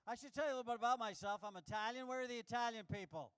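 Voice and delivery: ordinary talk, nothing marked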